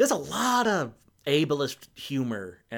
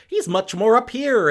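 pitched up high and louder, showing his range; higher voice demo; 9 semitones higher, 6 dB louder than usual. Shown as a demonstration. Reviewer showing the actor's higher voice, pitched up. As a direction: lift the voice up high to show it. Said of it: "much more of my vocal range".

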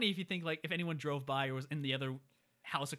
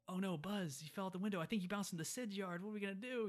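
fake dismay; 6 semitones higher, 3 dB quieter than usual. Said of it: "play it off like".

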